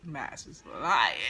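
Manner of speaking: usual speaking voice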